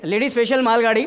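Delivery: ordinary delivery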